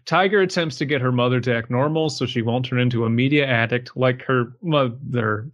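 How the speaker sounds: usual speaking voice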